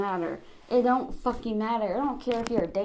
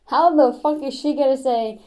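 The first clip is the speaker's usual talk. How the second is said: angry; 3 semitones higher, 8 dB louder than usual. Real, not acted. Reacting furiously to the clip.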